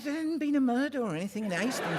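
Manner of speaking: put-on old lady teases Poirot about murders. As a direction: tell it like a tickled little old lady. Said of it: "She said".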